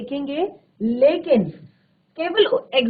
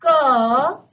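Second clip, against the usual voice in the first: क stretched very slowly as "kaaa"; drawn-out sound. Shown as a demonstration. Teacher slowly stretches क to show its vowel. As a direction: hold क long so the "a" sounds. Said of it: "का उच्चारण करने के लिए हमें अ का स्वर".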